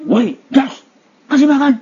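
loud, sharp high yell at a sibling; harsh shouting. Shown as a demonstration. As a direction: bark the order like a bossy older sibling. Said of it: "Tapi kalau caranya gimana?". (teriakan kasar yang keras)

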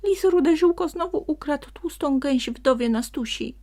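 high, loud sprite voice with bad news; a small forest sprite blurting out alarm; upset little sprite. A narrator's reading. Sprite tells the donkey the fox stole again, high and loud.

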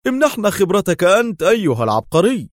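high, loud sarcastic jab; mock him, tense; nervous sarcasm. A narrator's reading. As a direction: Dar mocks Jer with nervous sarcasm. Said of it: "قال دار في سخرية عصبية".

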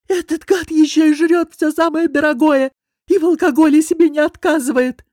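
high, loud cartoon voice, strained; cartoonish wheeze. Hoarse boss complains about the guest in a cartoonish voice. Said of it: "просипел почти мультяшным голосом".

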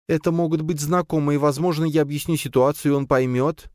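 high, lightly voiced woman; young woman voice. A narrator's reading. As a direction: speak as a nervous young wife. Zina's voice in narration. (голос молодой женщины)

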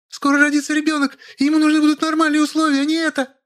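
very high whining wife; indignant squeal. A narrator's reading. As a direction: squeal the complaint in falsetto. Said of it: "взвизгнула Машенька". (возмущённый визг)

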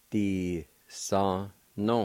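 "Desano" drawn out very slowly, each syllable separate; slow syllable-by-syllable model. Shown as a demonstration. Teacher modelling a new word for students, very slow. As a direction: stretch "Desano" slowly so the learner hears every sound. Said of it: "It's pronounced like this".

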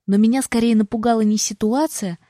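ordinary delivery

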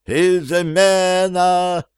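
drawn-out high alarmed cry; yelling. Acted. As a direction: scream the alarm. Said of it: "завопил Эдуард второй".

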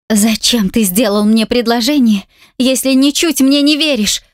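a tearful heroine's outcry with a lifted voice; cry out. A narrator's reading. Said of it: "Вскрикиваю громко".

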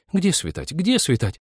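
put-on high, eager girlish objection; fervent girls' retort; heated protest. A narrator's reading. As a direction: protest hotly in a high pitch. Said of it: "жарко отозвались за окном".

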